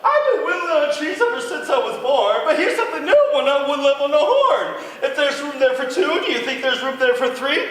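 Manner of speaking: very high, loud, fast laughing bird; a giddy little bird chirping. Acted. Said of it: "he chirped".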